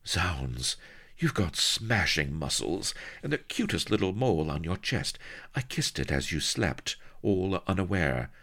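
usual speaking voice

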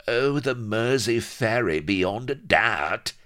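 high, scornful and drawn out at the end; violent sarcastic cry. Acted. Vladimir mocking his captain's question about the ship, violent cry. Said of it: "cried Vladimir violently".

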